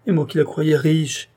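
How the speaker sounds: pained, animated outcry; distressed exclamation. A narrator's reading. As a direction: exclaim it in dismay and regret. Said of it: "s'écria-t-il".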